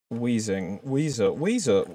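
usual speaking voice

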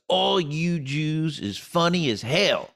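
slow Southern drawl, a notch up; Kentucky booker. Retold or imitated. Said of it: "And he goes".

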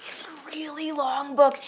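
hushed whisper, a bit slow. Real, not acted. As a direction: whisper it like a secret. Whispered aside about book length.

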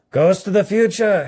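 a high, fearful cry to the ghost; exclaiming. A narrator's reading. Scrooge crying out to the silent ghost, exclaiming. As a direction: call out to the phantom in awe. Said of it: "he exclaimed".

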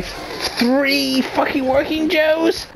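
slow, very high wail of dismay; panicked wail. Real, not acted. Player cornered by three androids, panicked wail. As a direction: draw it out high, nearly squealing.

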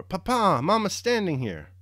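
high, loud call through the door; a small boy yelling for his father; child's shout. A narrator's reading. Little boy shouting to his papa through the door, loud and high. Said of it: "little Andrew shouted".

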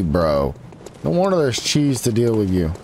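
ordinary talk, nothing marked

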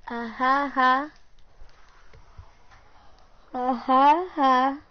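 warming up an impression voice; Spencer. Shown as a demonstration. Getting the voice for Spencer, mock laugh. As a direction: punch out trial laughs to find the voice. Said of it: "me get my voice".

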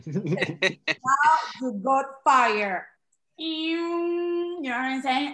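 slow, drawn-out mock rapper talk; hammed-up imitation of a rap star. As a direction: stretch each phrase with loud swagger. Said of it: "yo escogí a [name]".

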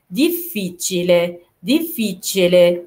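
"ديفيتشيلي" with a long double f; doubled f. Shown as a demonstration. Teacher modelling repeated letters, doubled f. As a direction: split "ديفيتشيلي" on the held f. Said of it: "لازم ننطقها مرتين".

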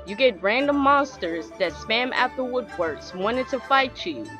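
ordinary delivery

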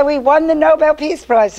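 high, loud joyful shout of the news; retold screaming of a big win. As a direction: shout it out in delight. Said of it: "I start screaming".

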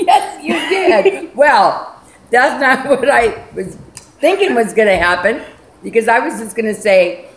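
lips coated with cream; speaking through cream on mouth; whipped cream on face. Real, not acted. Seen 0: Woman speaking has whipped cream covering face and lips after pie hit.

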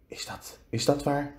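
aarzelend fluisteren, zachte inzet; gefluisterd. A narrator's reading. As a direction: fluister de vraag als Anderling. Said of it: "fluisterde Anderling". (fluisterend, met haperend begin)

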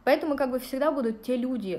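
normal talking voice

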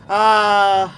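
long, loud, high "aah" of fright; sustained high yell; startled scream. Real, not acted. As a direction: scream out in alarm. Startled by enemy in game.

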